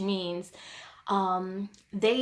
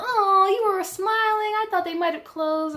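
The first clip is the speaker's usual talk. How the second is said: high, loud, gushing mimicry; hammed-up happy orthodontist voice; mock-chipper orthodontist; 11 semitones higher, 7 dB louder than usual. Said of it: "people being on a hundred and happy".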